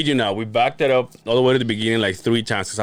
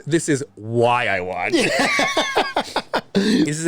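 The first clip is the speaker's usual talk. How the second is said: laughing wife; 6 semitones higher, slower than usual. Retold or imitated. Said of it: "she's in tears laughing".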